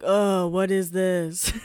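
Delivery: slow, lightly voiced puzzled man; drawn-out mock confusion; lightly voiced boyfriend hearing a voicemail; puzzled guy. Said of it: "he was like".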